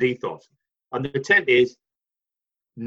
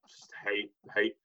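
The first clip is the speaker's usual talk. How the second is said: low, quiet mock [name]; 7 semitones lower, 12 dB quieter than usual. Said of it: "with [name] going".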